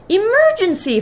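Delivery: high-pitched emergency call; alarmed cry. A narrator's reading. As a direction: cry out as a panicked storybook father. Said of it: "Father cried".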